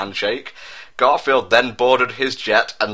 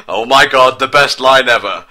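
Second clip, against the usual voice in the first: loud, higher, thrilled reaction; real glee at a ridiculous line; delighted; 4 semitones higher, 8 dB louder than usual. Real, not acted. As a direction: gush over the line loudly. Reader delighted by fic line.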